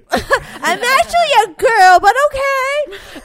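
hammed-up sarcastic correction; sassy comeback. Telling a child she's actually a girl, sassy and drawn out. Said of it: "I'm like".

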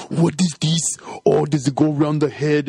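hammed-up action star playing puzzled; Schwarzenegger. Host mocking action star in a kid movie, hammy impression. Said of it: "the moment you try to".

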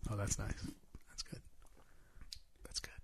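soft ASMR whisper. Pretend. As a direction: hush it close to the mic. Joining the ASMR bit.

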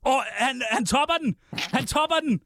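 high-pitched amazed outburst; excited exclaiming. Real, not acted. Guest topping the celebrity barometer, excited high exclamation. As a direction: marvel at it, voice jumping up high.

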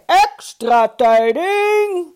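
high, drawn-out newsboy-like cry; loud, stretched high call; shout. A narrator's reading. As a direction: shout it slowly to the hall. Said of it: "opeens schreeuwde hij".

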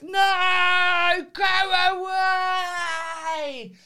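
high, loud, very slow sung-out wail; mock wail. Mocking herself waving off the lad in a slow wail. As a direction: drag it out like a dramatic wail. Retold refusal, drawn out.

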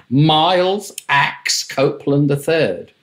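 slow, savouring repeat of the grand name; slow wonder at a big name; awed repeat. Real, not acted. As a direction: roll the name out slowly, still amazed.

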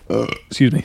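words broken by a burp; talking while burping. Real, not acted. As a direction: burp mid-sentence, then excuse yourself. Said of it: "I'm really sorry for the burps".

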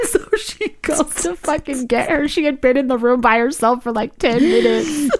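high-pitched, laughing through the telling. Real, not acted. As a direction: struggle to tell it through laughter. Laughing while telling the story.

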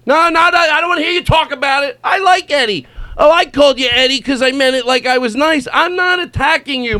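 mock indignant. Mocking the insane friend.

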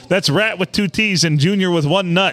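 usual speaking voice

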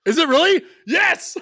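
high, loud delighted cheer; real excitement. Real, not acted. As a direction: burst out thrilled, breaking into a laugh. Delighted at card making deck.

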